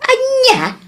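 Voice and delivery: high, sharp shocked question; very high startled "Anya?"; shocked "what?". Acted. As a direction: jump up in disbelief. Mother shocked at news.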